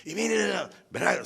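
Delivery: mimicked high-pitched, pushy questioning; insistent prompting. Opponent pushing the preacher to agree, insistent prompting.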